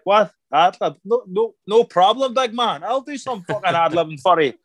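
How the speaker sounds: cocky bravado. Retold or imitated. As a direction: brush it off like it's nothing, big man. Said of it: "I'm like".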